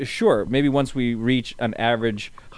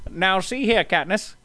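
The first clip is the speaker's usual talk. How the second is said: higher, lightly voiced old director; old-timey producer voice; 6 semitones higher than usual. Voicing old Hollywood producer.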